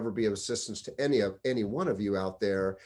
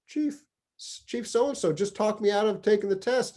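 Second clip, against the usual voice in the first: high-pitched, lightly acted dejection; mock dejected, almost crying; 9 semitones higher than usual. Battalion chief complains to his boss, mock dejected. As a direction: imitate someone crestfallen, pitched way up. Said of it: "all dejected, almost crying, going".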